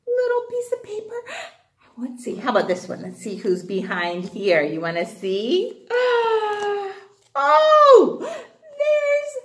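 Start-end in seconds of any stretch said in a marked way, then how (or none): 5.9-9.3 excited gasp. Acted. Reveal of picture.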